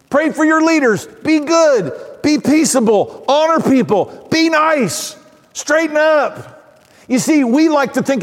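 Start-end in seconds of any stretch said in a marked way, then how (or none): 0.1-6.6 high-pitched string of short orders; higher, separate commands with falling ends; mock exhortation. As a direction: hand out rules like a scolding preacher. Acts out list of behavior commands.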